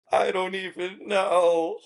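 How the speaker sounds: high, drawn-out helpless voice; clueless nurse. Retold or imitated. Nurse answering the room, high clueless drawl. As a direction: stretch it out high, at a loss.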